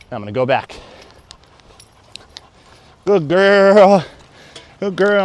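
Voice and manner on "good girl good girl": excited dog praise. Real, not acted.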